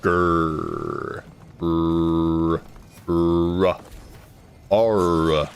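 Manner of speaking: "Grr" growled out with a long rolling R. Shown as a demonstration. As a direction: growl "Grr", dragging the R sound. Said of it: "so annoying in English?".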